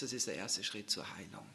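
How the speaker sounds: ordinary talk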